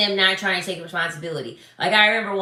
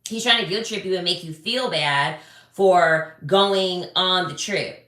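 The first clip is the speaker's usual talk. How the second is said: ordinary delivery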